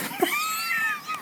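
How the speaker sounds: high burst of laughter; shrieking laugh. Real, not acted. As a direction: squeal with laughter.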